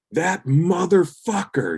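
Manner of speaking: high, slow, disbelieving curse; imitating a guitarist losing it over a remaster; mock fury. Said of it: "and just lost it".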